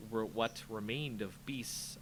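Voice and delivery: ordinary delivery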